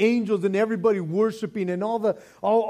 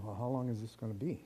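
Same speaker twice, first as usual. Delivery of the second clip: impatient thought; 7 semitones lower, 12 dB quieter, faster than usual. Retold or imitated. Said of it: "you're going".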